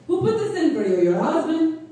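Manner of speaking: a nosy, pushy question from a man who assumes she is married; nosy super's voice. Retold or imitated. Building super quizzing the tenant, a hint of a pushy nosy man.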